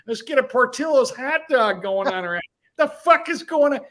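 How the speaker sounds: a tough-guy hype man demanding super chats; mock mafia coach yelling at the stream; mobster pep talk.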